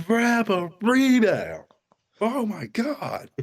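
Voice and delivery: high, drawn-out exasperated yell; high, slow holler of frustration; screaming at the TV. Retold or imitated. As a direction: wail it like a fed-up fan. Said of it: "screaming at the T V".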